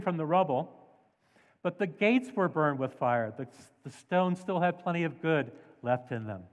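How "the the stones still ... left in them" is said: ordinary delivery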